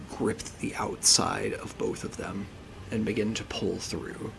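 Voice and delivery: slow, hushed, suspenseful narration; game master scene setting. Acted. Game master revealing a creature to the players, slow ominous narration. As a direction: draw it out softly, with long pauses for dread.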